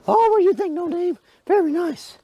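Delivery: very high cooing praise. Real, not acted. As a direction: coo at the dog, pitch way up. Cooing to the dog.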